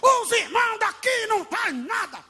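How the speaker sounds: a sung, shouted grumble about a dead church; mock complaint shout. Worker complains about his church, shouted high.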